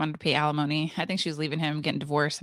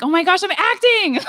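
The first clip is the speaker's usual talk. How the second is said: high, loud laughing exclamation; shocked and amused; 13 semitones higher, 9 dB louder than usual. Retold or imitated. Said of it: "I'm like".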